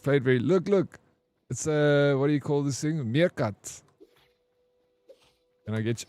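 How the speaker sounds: ordinary talk, nothing marked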